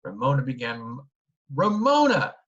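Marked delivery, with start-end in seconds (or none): 1.5-2.3 high, loud shocked exclamation; shocked scolding. A narrator's reading. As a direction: call her name in dismay. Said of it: "began Mother and stopped".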